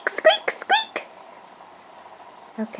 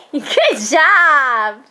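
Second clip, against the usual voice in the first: excited praise; 3 semitones higher, 4 dB louder than usual. Real, not acted.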